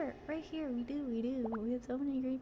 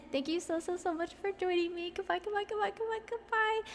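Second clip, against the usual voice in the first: cheery goodbye; 7 semitones higher, faster than usual. Real, not acted. Cheery stream sign-off.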